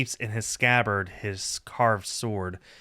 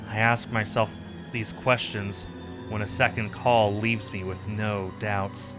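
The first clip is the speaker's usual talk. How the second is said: plain reading voice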